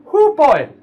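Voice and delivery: high, loud whoop; reminiscing exclamation. Acted. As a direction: exclaim at a wild memory. Old adventurer recalls past.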